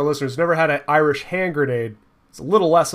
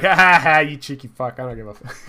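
mock Irish; 5 dB louder than usual. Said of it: "they'd be like".

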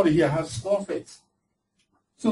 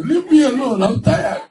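higher, laughing, whiny plea; mock whining plea; 8 semitones higher, 3 dB louder than usual. Preacher mocking people who beg problems, higher whiny laugh. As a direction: mimic a tired person begging their problem. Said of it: "They are begging their problems".

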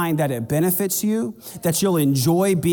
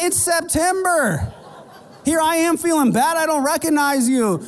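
mock exasperation; 11 semitones higher than usual. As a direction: play incredulous and amused. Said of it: "I'm like".

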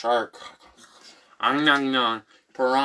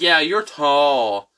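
Amos, loopy; 7 dB louder, much slower than usual. Retold or imitated. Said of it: "And then he was like".

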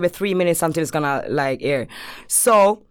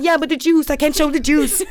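higher-pitched mock plea; retold helpless protest, pitched up; pleading excuse; 6 semitones higher than usual. Straggler to friend about the juice, pleading.

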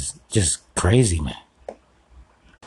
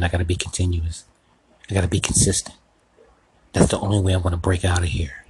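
ordinary delivery

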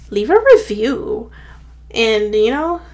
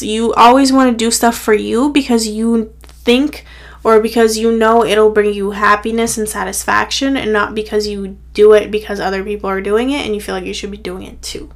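normal talking voice

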